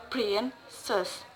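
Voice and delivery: Princess split softly, PRIN then cess; stress on first syllable. Shown as a demonstration. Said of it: "word stress put in the first".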